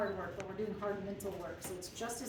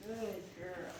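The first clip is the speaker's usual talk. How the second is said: soft, lower murmur of praise; low hushed praise; 3 semitones lower, 6 dB quieter than usual. Real, not acted. Trainer praising a wound-up dog, low and hushed. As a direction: keep it hushed and calm. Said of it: "keeping my tone low and almost hushed".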